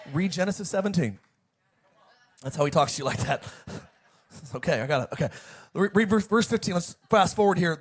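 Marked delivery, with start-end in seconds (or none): none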